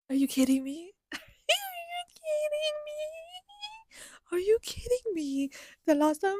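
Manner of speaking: high, strained voice breaking into a squeak; tearful disbelief. Real, not acted. I'm gonna start crying.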